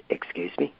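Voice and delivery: breathless, high shocked excuse me; startled breathy reply; stunned disbelief. Retold or imitated. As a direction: caught off guard by the insult.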